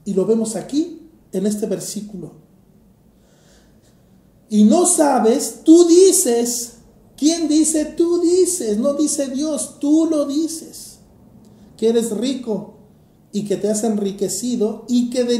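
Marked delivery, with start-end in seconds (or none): 4.5-11.0 "tú dices" higher and louder, tú punched; stress on tú. Acted. Preacher presses the congregation, tú hit high and loud. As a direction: drive "tú" home each time. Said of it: "preacher hammering on who says it".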